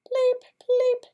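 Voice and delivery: very high, loud squeaky peeps; cricket chirping. Acted. Teacher voices crickets leaping to the duck, high squeaky chirps. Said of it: "what does it sound like when they're chirping".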